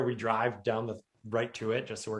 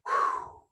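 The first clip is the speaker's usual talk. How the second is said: relieved whew. Retold or imitated. Relief exhale after exertion.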